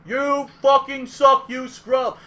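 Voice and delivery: loud, slow jeering shout; megaphone taunt. Retold or imitated. Heckler taunting Duck Junior through megaphone, loud jeer. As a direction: jeer right in his face. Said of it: "he goes".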